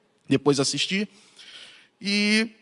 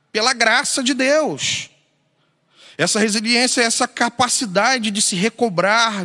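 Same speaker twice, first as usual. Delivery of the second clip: higher, urgent preaching voice; bevlogen preken; 6 semitones higher than usual. Real, not acted. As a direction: preach it with rising, insistent conviction.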